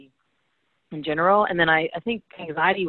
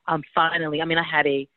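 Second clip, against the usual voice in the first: ordinary talk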